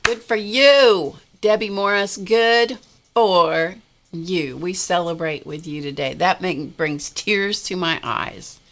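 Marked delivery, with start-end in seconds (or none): 0.0-4.6 heartfelt congratulations. Real, not acted. Debbie rang the cancer-free bell.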